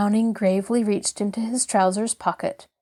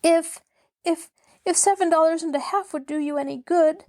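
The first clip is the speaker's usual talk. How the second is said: higher voice, stumbling over the first word; falter, then rush the offer out; desperate pleading; 7 semitones higher, faster than usual. A narrator's reading. Mabel offers the landlord their money, desperately pleading.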